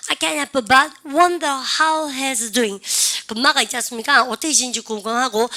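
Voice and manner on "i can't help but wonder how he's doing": drawn-out model sentence, each word clear; slow model reading. Shown as a demonstration. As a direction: read it slowly like a teacher's model. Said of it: "아니면 합니까?".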